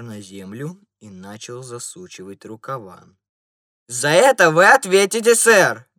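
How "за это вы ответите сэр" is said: loud, high threatening yell; furious gentleman shouting loudly; angry shout. A narrator's reading. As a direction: shout the threat as you roll up your sleeves. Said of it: "крикнул он".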